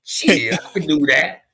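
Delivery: high, loud brash street talk; cocky slangy applicant. Retold or imitated. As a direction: brag like a cocky street guy. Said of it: "When you go to an interview".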